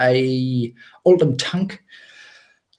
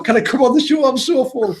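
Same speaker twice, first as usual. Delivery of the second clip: higher-pitched mock pleading; retold plea; 8 semitones higher than usual. Guest too full from dinner asks John about the show, mock pleading. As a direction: plead in a raised, put-upon voice.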